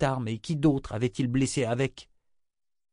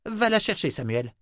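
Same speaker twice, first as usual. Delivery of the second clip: high, impatient command; impatient order; 9 semitones higher than usual. A narrator's reading. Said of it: "la voix vibrant d'impatience".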